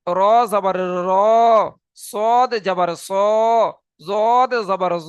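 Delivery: "জাবারে র", "জাবারে স", "জাবারে জ" each ending on a short rounded o, not a; heavy o vowel. Shown as a demonstration. Teacher showing heavy letters with zabar, said slowly. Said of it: "এমনটা করে উচ্চারণ হবে".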